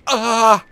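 loud, high sudden yell; jolted loud cry; startled yell. Real, not acted. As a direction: shout at the interruption. Attacked while eating soup.

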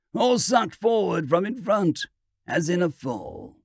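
ordinary delivery

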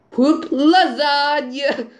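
slow, stretched goofy call; mock-dramatic slow exclamation; drawn-out silly shout. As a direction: drag out each word for a laugh. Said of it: "Of course!".

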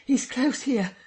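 high, loud urgent cry. Acted. Kemp cries to the crowd, high and urgent. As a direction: alert the crowd sharply. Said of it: "he cried".

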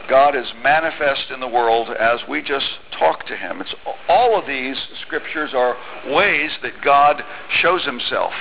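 usual speaking voice

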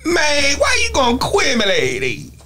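high-pitched garbled rapper; mumbling hip-hop impression. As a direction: slur it like a mocked hip-hop act. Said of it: "What do they talk like this".

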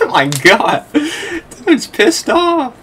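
high, loud, laughing disbelief; delighted at the rival's rage. Real, not acted. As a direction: cackle it out slowly. Rival player raging.

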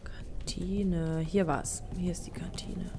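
whispered to himself while searching; hushed breathy search. Real, not acted. Player whispering while sneaking into the canteen.

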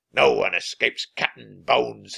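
loud, menacing pirate voice; booming villain; pirate captain's roar. A narrator's reading. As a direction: roar a threat like a pirate boss. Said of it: "he roared".

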